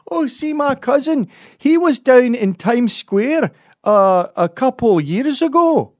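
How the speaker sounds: loud, high, slow caricature Scot; Scottish accent. Retold or imitated. Scot telling an American about a cousin, loud mock Scottish accent. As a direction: draw it out in a piercingly high Scottish voice. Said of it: "they always say the same thing".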